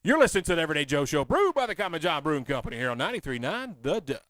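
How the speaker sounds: loud, fast station ID like a radio host; radio announcer read. Acted. Host reads the sponsor tag to radio listeners, announcer-style. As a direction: punch it out like a station promo.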